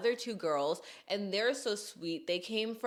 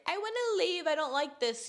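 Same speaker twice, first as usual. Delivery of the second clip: whiny complaint; 10 semitones higher than usual. Acted. Imitating a Debbie Downer killing the vibe, whiny. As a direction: imitate a party pooper whining to go. Mimics a whiny party pooper.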